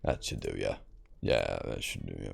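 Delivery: low, gruff mock smith voice; gruff blacksmith voice. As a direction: growl it like a grumpy blacksmith. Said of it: "I'm a gruffy, sunny man".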